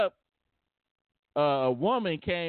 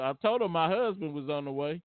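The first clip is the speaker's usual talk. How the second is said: ordinary talk, nothing marked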